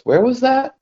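high-pitched, questioning mock surprise; retold sharp query. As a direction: act out someone suddenly needing to know. Officer reacts to reported missing trap.

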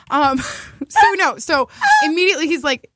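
ordinary talk

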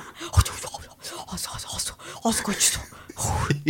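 breathless whispering panic; flustered impression. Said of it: "焦ってる時のあなた". (焦った人のささやき声真似)

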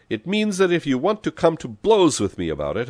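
loud, high challenge; heated threat. A narrator's reading. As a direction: dare the rival to fight. Threatens rival with a fight.

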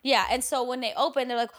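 ordinary talk, nothing marked

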